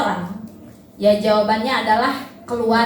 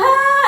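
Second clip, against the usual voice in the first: hah laugh; 12 semitones higher, 5 dB louder than usual. Retold or imitated. Parent laughs at toddler.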